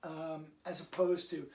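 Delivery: ordinary delivery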